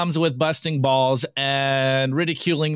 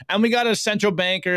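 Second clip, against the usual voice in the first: ordinary delivery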